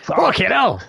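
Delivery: loud exclamation. Real, not acted. Laughing outburst at own bit.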